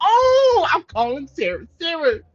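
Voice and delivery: sudden high shout; outraged shout. Real, not acted. Fed up with the tenant, a sudden high shout. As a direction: burst out loud and high.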